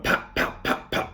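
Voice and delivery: unvoiced popping sounds; whispered pops. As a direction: tap out soft pops. Imitating heads popping up.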